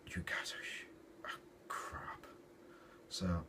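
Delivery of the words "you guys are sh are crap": hushed scornful aside, almost whispered; muttered jab. Retold or imitated. Fan judging the Avengers, hushed scorn. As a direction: mutter it under your breath. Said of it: "You think".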